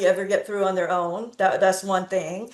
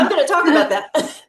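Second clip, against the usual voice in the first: laughing; 8 semitones higher, 6 dB louder than usual. Real, not acted. Laughing while reassuring the speaker.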